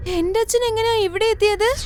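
high-pitched, surprised question; acted bewilderment; puzzled girl. Girl startled by the claim.

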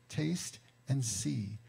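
"Taste and see" drawn out very slowly, each word weighed; slow emphatic. Shown as a demonstration. Preacher savouring the psalm verse, slow emphasis. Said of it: "Notice the verbs".

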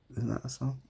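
ordinary talk

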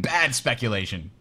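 low, stern disapproving verdict. Pretend. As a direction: pronounce it like a stern judge. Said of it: "Overseer Cat disapproves".

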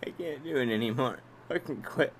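very soft, low, spent voice; defeated. Real, not acted. Streamer who can't do it anymore, quiet and defeated. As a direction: curse quietly, with nothing left.

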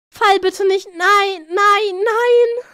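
high, loud panicky repeated no; alarmed pleading. Real, not acted. Streamer begging it not to fall, panicky.

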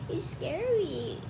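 soft, slow little voice; quiet mock fear. Kid reacts to Dad's Gollum, soft mock fright. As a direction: say it small and slowly.

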